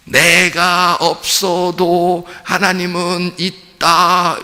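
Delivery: slow, stretched-out mock assertion; mock objection. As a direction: draw out every word as if imitating someone insisting. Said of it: "그렇게 말하고 싶잖아요, 누가". (길게 늘여 흉내 내는 말투)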